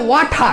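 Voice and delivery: "ওয়াঠা" with t as aspirated ঠ; British-style "ওয়াঠা", final r left silent; British pronunciation. Shown as a demonstration. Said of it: "টিটার উচ্চারণ করে ঠ".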